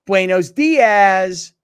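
over-enunciate like a tourist; hammed-up Spanish. Shown as a demonstration. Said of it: "hammed Spanish greeting".

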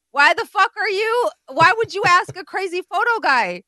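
high-pitched, incredulous telling-off; exasperated scolding. Acted. Scolding the show's character in disbelief.

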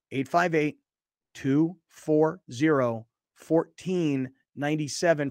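slow, spaced-out phone number. Shown as a demonstration. Host repeating the phone number for people driving, slowly. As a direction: read the number out slowly, group by group. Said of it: "I'll say it slower".

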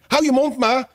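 put-on high-pitched young crab snap; snappish. A narrator's reading. Young crab answering its mother, snappish. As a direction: snap back at a lecturing parent. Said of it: "zei de jonge krab nogal snauwerig".

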